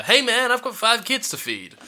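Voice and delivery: high, eager cab driver; exclaiming cab driver. Retold or imitated. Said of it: "the man that keeps exclaiming".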